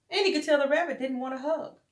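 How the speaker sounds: plain reading voice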